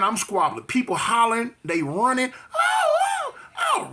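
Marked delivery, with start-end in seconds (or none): 2.5-3.9 very high panicked shout. Retold or imitated. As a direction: yell out in panic. People hollering in fight.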